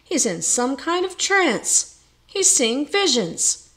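high, slow exclamation; crying out. A narrator's reading. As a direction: marvel loudly at a trance. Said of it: "cried Oswald".

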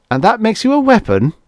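pitched high and loud in disbelief; incredulous. A narrator's reading. Bates asking the Oracle in disbelief, incredulous. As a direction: ask it like you can't believe it. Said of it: "asked Bates incredulously".

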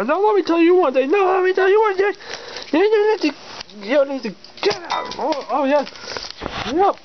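high, sputtering cartoon villain threat; sputtering foiled villain. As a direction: vow revenge like a beaten villain.

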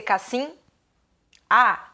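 "cassim A" as CAS-SIM, long gap, then A; beginner's misreading. Retold or imitated. Child reading casinha with silent H, beginner's misreading. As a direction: sound out cassim, pause, then a lone A. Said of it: "você disse pra mim que o H é mudo".

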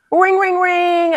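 high, drawn-out ring ring ring; sing-song the phone ringing slowly; phone ring imitation. Picturing Putin getting the call, slow phone ring imitation.

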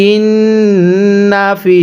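ইন্নাফি with the নু kept short; no stretch on nun. Shown as a demonstration. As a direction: keep the নু in ইন্নাফি short, no drawing out. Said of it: "নুনের মধ্যে টান দিব না".

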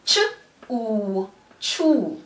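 short "ch", long "oo", then "chew" joined; sounding out and blending. Shown as a demonstration. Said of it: "So it is".

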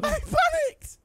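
very high squealed protest; panicked excuse. Real, not acted. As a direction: squeal it in self-defence. Repeating excuse for blunder.

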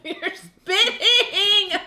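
laughing. Real, not acted. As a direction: crack up while saying "You're spitting".

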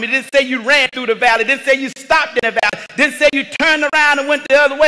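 ordinary talk, nothing marked

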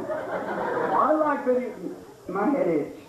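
high, very slow dopey voice; mock the nerd who scratched his neck; slow-witted gamer. Mocking the audience gamer, high dopey slow voice.

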